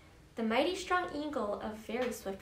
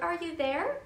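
raised, questioning call; 6 semitones higher, 3 dB louder than usual. A narrator's reading. Horton searching clover for his friends, calling out. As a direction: call out hopefully, pitch lifting. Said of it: "he called out".

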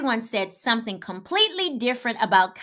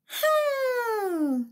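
thoughtful hmm; 12 semitones higher, 4 dB quieter than usual. A narrator's reading. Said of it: "Lizzie thought".

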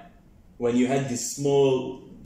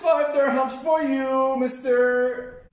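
showman reveal; 11 semitones higher, 4 dB louder than usual. Acted. Presenting magic money.

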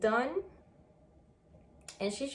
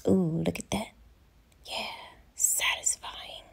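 whisper; 3 semitones lower than usual. Real, not acted. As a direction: whisper it in awe. Admiring the fresh lip result.

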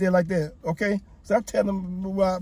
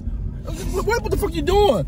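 sudden high startled yell; jolted by the car veering, cry out; real alarm in the voice; alarmed outburst; 10 semitones higher, much slower than usual. Real, not acted. Alarmed at the driver's swerving.

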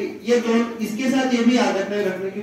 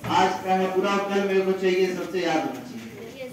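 usual speaking voice

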